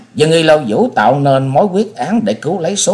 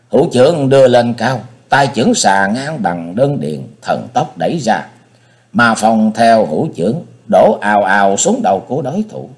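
ordinary delivery, nothing marked

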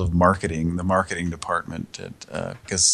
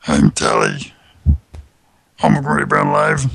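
Telly voice; 6 semitones lower, 5 dB louder than usual. Retold or imitated. Host imitating Telly from Sesame Street, loud. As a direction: play the anxious Sesame Street monster. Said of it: "It's Telly from Sesame Street".

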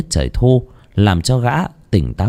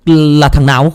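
loud, raised-pitch demand; agitated outburst; 6 semitones higher, 6 dB louder than usual. Acted. Framed man demanding the real culprit, agitated. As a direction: burst out in agitation. Suspect frantic at being framed.

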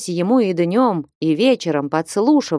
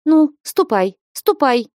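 high-pitched, urgent dismissal; shouting; 8 semitones higher, 4 dB louder than usual. A narrator's reading. Флёнушка pushes the flustered envoy out, shouting. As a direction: shoo him out with a raised cry. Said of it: "крикнула Флёнушка".

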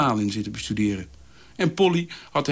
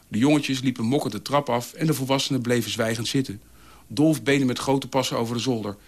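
ordinary delivery

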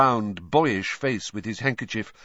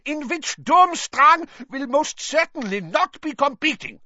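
high, loud furious outburst; exploded; 7 dB louder than usual. A narrator's reading. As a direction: burst out in rage. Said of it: "exploded Karkaroff".

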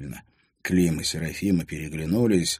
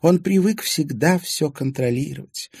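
put-on old lady describing a controlling man; knowing old lady; 9 semitones higher, 4 dB louder than usual. A narrator's reading. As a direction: explain his ways knowingly.

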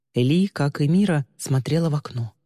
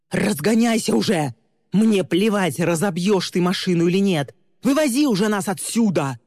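raised, higher, furious shouting; flaring up; 6 semitones higher, 4 dB louder than usual. A narrator's reading. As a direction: shout orders at the driver, out of patience. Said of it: "вспыхнул Тристин и хлопнул рукой". (гневный крик)

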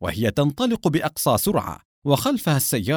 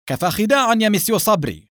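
raised-pitch, stern command; stern warning; 7 semitones higher, 3 dB louder than usual. A narrator's reading. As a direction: cut him off with a higher-pitched threat. Inspector threatens at gunpoint. (تحذير حازم بنبرة مرتفعة)

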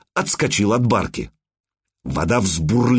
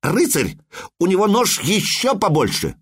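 put-on boy boasting; 7 semitones higher than usual. Boy boasts about his father.

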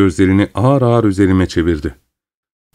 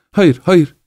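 protesting refusal; 6 semitones higher than usual. A narrator's reading. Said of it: "diye itiraz etti ve yumruklarını sıkarak".

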